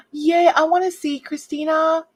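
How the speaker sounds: high, bright excited squeal. Real, not acted. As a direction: gush with delight. Excited about a friend's post.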